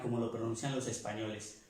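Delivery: ordinary delivery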